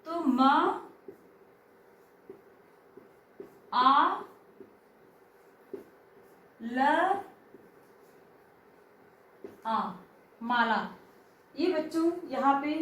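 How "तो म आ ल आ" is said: म, आ, ल, आ spelled out with long pauses; sound by sound. Shown as a demonstration. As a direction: split Mala into its letters slowly. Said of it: "वर्णों को हम अलग अलग करेंगे". (माला को वर्ण-वर्ण अलग करके बोलना)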